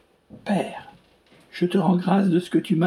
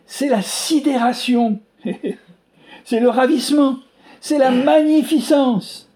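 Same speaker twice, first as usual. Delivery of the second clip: slow, louder and higher, building in wonder; rising awe; 6 semitones higher, 4 dB louder than usual. Real, not acted. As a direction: let each word swell with amazement. Preacher building to climax.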